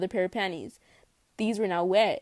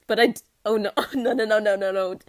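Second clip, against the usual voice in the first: laughing; 7 dB louder than usual. Real, not acted. Caught reading something embarrassing.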